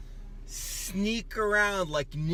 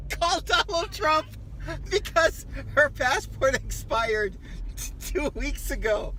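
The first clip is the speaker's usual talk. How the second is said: gleeful teasing; 9 semitones higher, 6 dB louder, slower than usual. Real, not acted. Teasing on phone, laughing.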